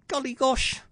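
put-on high, hushed boy's wonder; soft murmur. A narrator's reading. Jack gazing longingly at the tree, soft murmur. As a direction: murmur it softly in a lad's voice. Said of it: "He murmured softly".

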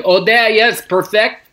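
a hint of a rural Quebec seller; French accent. Mimicking the Quebec truck seller, light French accent. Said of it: "That voice".

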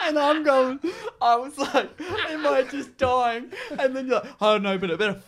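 high, loud, helpless laughter through the words; real laughing. Real, not acted. Prankster telling victims about hiding behind the couch, laughing hard. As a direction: struggle to tell it while cracking up.

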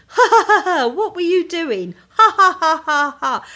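high, loud mock guffaw between words; mocking man laugh. Friend imitating the man's reaction to her car in the bog, mocking laughter. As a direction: hoot derisively like a man enjoying her blunder. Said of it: "I get the, the man thing".

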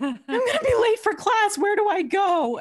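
hammed-up lost student panicking; mock panic. Student lost in the London Underground, laughing mock panic. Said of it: "I was like".

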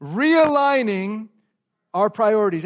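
very slow, drawn out, leading the room; slow unison title. Shown as a demonstration. Pastor leads congregation saying title, slow. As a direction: lead the church to say it together. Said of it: "Come on, say the subtitle with me".

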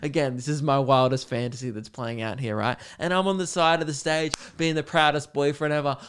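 normal talking voice

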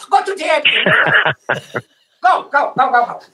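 mock Chinese accent urging him to go; Chinese shopkeeper accent. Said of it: "I'm just talking like him".

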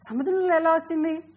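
high, loud, incredulous asking; astonished question. A narrator's reading. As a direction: ask in amazed disbelief. Boy puzzled by plastic in sea.

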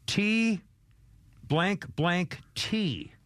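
slow, deliberate blank blank T; spelled out slowly. Shown as a demonstration. Host spelling out a censored word for listeners, slowly. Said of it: "it's a four-letter word".

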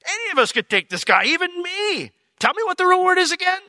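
high-pitched acted disbelief; imitating a disbelieving young challenger; incredulous. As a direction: climb into an eager, incredulous squeal. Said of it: "David's incredulous".

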